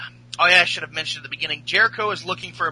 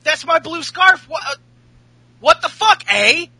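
mock outrage; 10 semitones higher, 4 dB louder than usual. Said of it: "he's like".

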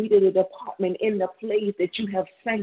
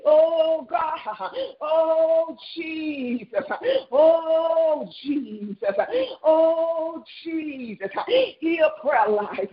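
fervent prayer cries; 5 semitones higher, slower than usual. Real, not acted. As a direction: cry out in earnest prayer.

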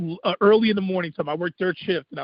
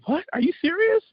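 retold disbelief; 10 semitones higher than usual. Acts out sound man's astonishment.

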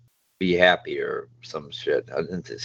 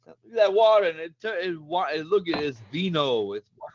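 a hammed-up Harvey Keitel New York accent, high and amazed; imitating Harvey Keitel's accent; 11 semitones higher than usual. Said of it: "with his accent, he was like".

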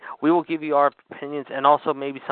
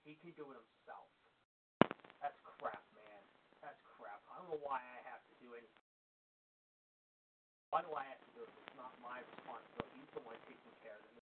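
far from the mic, much softer, grumbling complaints; off-mic phone argument; 16 dB quieter than usual. Real, not acted. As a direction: complain to the caller from across the room. Host arguing on phone off mic.

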